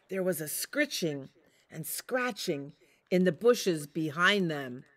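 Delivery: ordinary delivery, nothing marked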